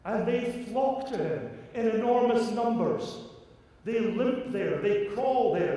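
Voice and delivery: high, loud, fervent preaching; impassioned preaching. Acted. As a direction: preach it with rising fire.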